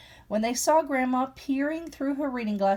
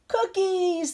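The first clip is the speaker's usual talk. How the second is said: cheering; 10 semitones higher than usual. A narrator's reading. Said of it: "the cub cheered".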